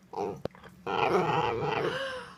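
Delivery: sharp surprised gasp; excited gasp. Real, not acted. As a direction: gasp in delight. Reacts as dog speaks.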